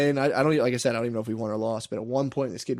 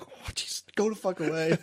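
lightly voiced slow, higher brush-off; imitated exasperated coach; 4 semitones higher, 4 dB quieter than usual. Coach spotted from the balcony, slow higher mock brush-off. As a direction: wave them off as the embarrassed coach, drawn out. Coach caught with hooker, retold.

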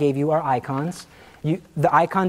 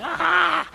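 mock shriek; 13 semitones higher than usual. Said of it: "impersonation of her".

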